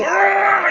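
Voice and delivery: long, high engine scream; imitated engine roar. Storyteller shows Manu setting off, high imitated engine scream. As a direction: mimic a car flooring it. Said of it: "Et il enquille".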